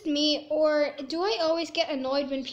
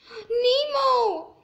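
sharp gasp, then a high cry; surprised cry of the name; gasping surprise; 8 semitones higher, 8 dB louder than usual. Real, not acted. Spots Nemo in game.

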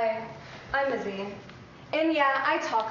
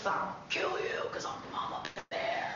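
whispered threat; 11 dB quieter than usual. Acted. Practicing a menacing promo line.

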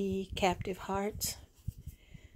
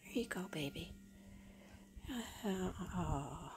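soft hushed lullaby whisper; whispering over a crib; 10 dB quieter than usual. Real, not acted. Settling doll, hushing.

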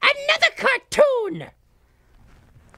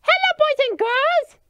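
put-on overbright kids party host; 4 semitones higher than usual. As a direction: snap back to sunny on-air host. Back on air, switches to host.

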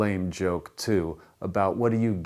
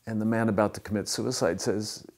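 usual speaking voice

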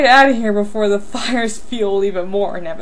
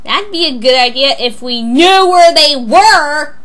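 louder, exasperated outburst; worked-up snapping; 3 semitones higher, 6 dB louder than usual. Acted. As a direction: lose your patience, building in volume. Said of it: "calm down".

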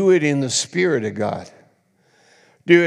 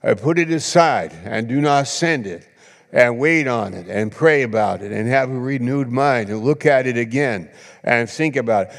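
ordinary talk

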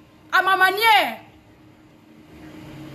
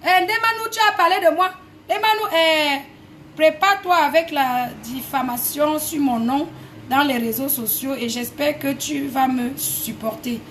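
mocking Raymond; 4 semitones lower than usual. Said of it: "Tes messages là que tu m'envoies".

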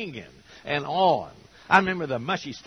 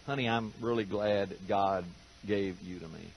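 softer, hushed sweet nothing to his wife; mock tender; 9 semitones lower, 9 dB quieter than usual. Husband tells his wife he's glad God gave her, softly. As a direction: murmur it gently like a husband in a hug.

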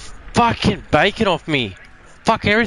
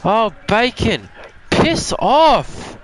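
swearing tirade; 4 semitones higher, slower than usual. Real, not acted. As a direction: rage at the players killing you. Furious at players in game.

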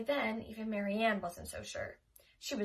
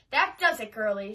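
put-on loud, fed-up old woman; losing patience; 10 dB louder than usual. Old neighbour losing patience with the girl, loud. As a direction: snap it out, done with them.

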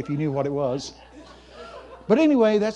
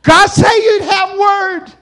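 high, loud, drawn-out wail; exaggerated tearful shout; mock crying; 13 semitones higher, 9 dB louder, slower than usual. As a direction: squall it like a blubbering man. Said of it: "on a little divan crying".

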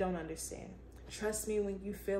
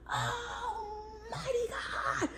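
tearful cry; 12 semitones higher, much slower than usual. Real, not acted. Overcome, crying out to God.

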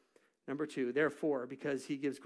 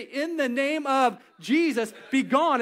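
loud high command, In the name of Jesus; commanding rebuke; 8 semitones higher, 8 dB louder, slower than usual. Acted. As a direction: cast it out with full force. Commanding the devil.

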